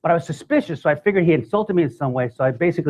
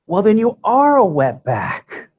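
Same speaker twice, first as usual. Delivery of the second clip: higher, slow, sugary-sweet teacher voice; teacher insulting a child with a higher, slow, sweet voice; sweetly; 8 semitones higher, 4 dB louder, slower than usual. Retold or imitated. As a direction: imitate the teacher cooing it slowly and sweetly. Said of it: "But she said it so sweet".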